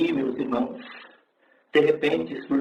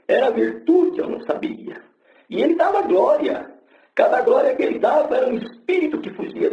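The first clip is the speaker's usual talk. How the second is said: preaching at full force; 9 semitones higher than usual. Acted. Testimony preacher to the congregation, fervent preaching. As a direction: lift the church with each glória.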